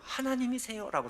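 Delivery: high, called-out question; crying out to God. Retold or imitated. Dazed man calling out to God, high cry. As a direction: call out to God in the night. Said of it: "소리를 쳤습니다".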